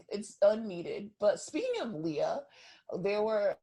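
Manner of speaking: normal talking voice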